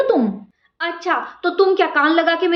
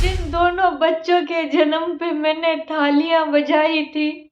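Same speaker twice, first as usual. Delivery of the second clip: slow despairing lament; slower than usual. Acted. Old mother lamenting her fate to God as they quarrel. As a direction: mourn it slowly, crushed.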